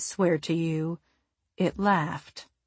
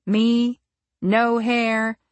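usual speaking voice

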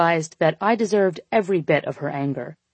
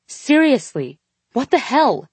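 angry; 7 semitones higher, 3 dB louder than usual. A narrator's reading. Angry woman demands answer.

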